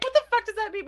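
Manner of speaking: high, loud laughing question; real laughing outburst; laughing disbelief. Real, not acted. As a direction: laugh out the confusion. Laughing at baffling line.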